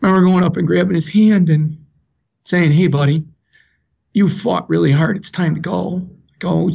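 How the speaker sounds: choked up, pitch lifting as it goes; real emotion in the voice, pausing between phrases; emotional. Real, not acted. Father tells his dying son it's okay to go, emotional. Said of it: "Sorry, I get a little emotional now".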